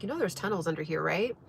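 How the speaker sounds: hushed and quick, a hint of secrecy; secretive. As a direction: lean in and murmur it like a secret. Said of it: "it was, like, secretive".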